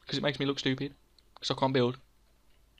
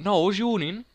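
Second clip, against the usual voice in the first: retold protest; 7 semitones higher, 5 dB louder than usual. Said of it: "And I was like".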